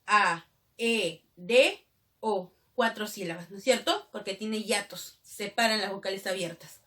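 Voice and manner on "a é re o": "A, E, D, O" as four separate beats; separated syllables. Shown as a demonstration. Teacher splitting a hiato, "A, E, D, O" syllable by syllable. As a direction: give each of "A, E, D, O" its own pause. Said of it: "Tienen que ir separados".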